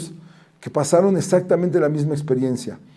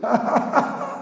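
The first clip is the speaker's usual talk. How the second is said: high laugh; 10 semitones higher, 5 dB quieter than usual. A narrator's reading. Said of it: "Rabbi Akiva se empezó a reír".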